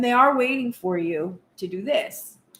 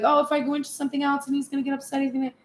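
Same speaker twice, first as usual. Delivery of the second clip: worried rush; 4 semitones higher, much faster than usual. Retold or imitated.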